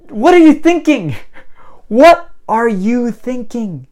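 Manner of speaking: high, loud, drawn-out disbelief; incredulous scolding. Acted. Rescuer scolds man wanting to swim back, incredulous. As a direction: build to an exasperated high yell.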